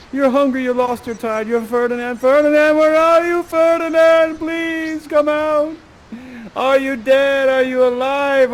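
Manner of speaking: high, loud, drawn-out anguished calls; desperate calling out. Acted. The king hunts his lost son, desperate high calling. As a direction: wail for someone lost across an island.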